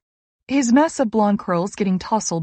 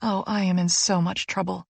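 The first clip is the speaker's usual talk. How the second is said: usual speaking voice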